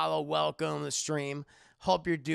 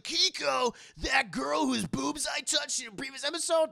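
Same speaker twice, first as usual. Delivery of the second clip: excited Kuwabara shout; 8 semitones higher than usual. Acted. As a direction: yelp it out like a lovestruck goof.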